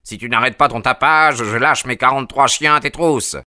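high, loud threat shouted down; angry threat. A narrator's reading. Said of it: "gronda une voix".

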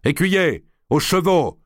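high, commanding call. A narrator's reading. D'Artois summoning his squire for the horses, calling out. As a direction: call the order out sharply to a servant. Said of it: "Puis il appela".

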